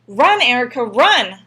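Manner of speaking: loud desperate scream. A narrator's reading. Boy screaming at his sister to run. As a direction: scream at her to flee. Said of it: "I screamed".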